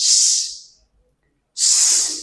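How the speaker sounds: long voiceless "shhh"; drawn-out sh sound. Shown as a demonstration. Teacher showing the first sound of she, drawn-out sh. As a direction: hold a hushing sh. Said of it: "এমন উচ্চারণ হয়".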